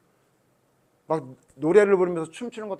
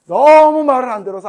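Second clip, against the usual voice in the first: loud laughing teasing line; 8 semitones higher, 17 dB louder than usual. Real, not acted. (크게 웃으며)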